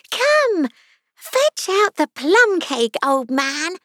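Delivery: high, commanding creature voice; bossy unicorn. A narrator's reading. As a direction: order an underling about, high and drawn out. Unicorn orders the king about.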